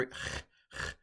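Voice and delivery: ch as a breathy throat fricative; rough ch sound. Shown as a demonstration. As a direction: rasp the ch like German Bach. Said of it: "Chi is a".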